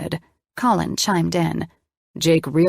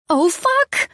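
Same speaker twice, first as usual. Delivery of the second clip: sudden high panicked shriek; 12 semitones higher, 4 dB louder than usual. A narrator's reading. Diner finds raw chicken, shrieking in panic. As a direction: blurt it out in a high, loud panic. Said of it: "I shrieked".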